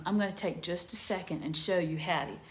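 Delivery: ordinary delivery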